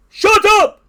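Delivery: very high, loud outburst; crying out. A narrator's reading. Toad silences the strange voice, high angry cry. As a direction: cut the announcer off with a yell. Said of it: "cried Toad".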